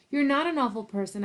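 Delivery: ordinary delivery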